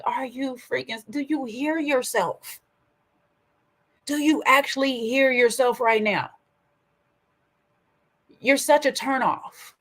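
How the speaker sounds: incredulous retort, slow on the second question; exasperated disbelief. Retold or imitated. Telling viewers what she thought of him, exasperated. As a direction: play back your fed-up reaction at him. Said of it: "I'm like".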